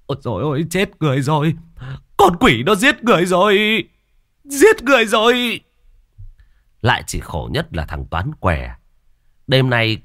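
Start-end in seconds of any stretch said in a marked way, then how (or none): 0.1-5.6 high-pitched cry of alarm; horrified shrieking; panicked screaming. A narrator's reading. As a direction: scream for help, building higher and higher. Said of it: "miệng liên tục gào thét".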